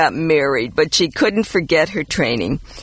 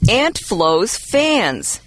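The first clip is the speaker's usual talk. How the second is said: slow sponsor name; 2 semitones higher, much slower than usual. Acted. Fake sponsor announcement.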